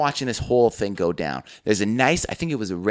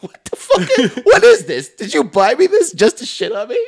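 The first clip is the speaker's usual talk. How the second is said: high, loud, laughing outrage; mock outraged, cracking up; laughing disbelief; 11 semitones higher, 7 dB louder than usual. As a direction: sputter in disbelief. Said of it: "I'm just looking like".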